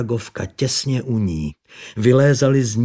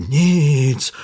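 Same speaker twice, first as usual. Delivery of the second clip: feverish tone; 5 semitones higher, 6 dB louder than usual. A narrator's reading. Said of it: "se zvláštním horečným přízvukem".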